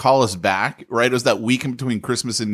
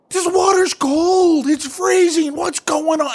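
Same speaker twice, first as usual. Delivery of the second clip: wife's freezing outburst; slower than usual. Retold or imitated. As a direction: mimic the wife yelling at cold water, much higher. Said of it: "my wife does not go off".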